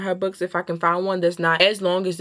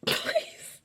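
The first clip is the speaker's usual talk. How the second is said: laughing please; 7 dB quieter than usual. Real, not acted. Laughing at her own joke.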